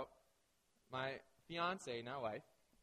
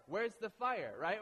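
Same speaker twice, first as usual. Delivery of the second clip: ordinary delivery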